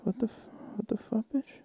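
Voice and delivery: high, hushed disbelief at the customer; what-the-hell disbelief, breathy and high; incredulous outburst. Real, not acted. Ranting at customer's queen excuse.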